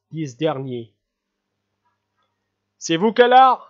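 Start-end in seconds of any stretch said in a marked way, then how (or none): 2.8-3.6 loud startled exclamation, higher; startled outcry at a man behind him; astonished cry. Acted. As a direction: blurt it out in surprise. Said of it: "s'écria le prince avec étonnement".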